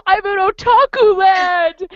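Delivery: high, drawn-out gushing; mock overjoyed fan; excited squeal. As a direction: squeal it like a fangirl arriving. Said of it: "my first words were".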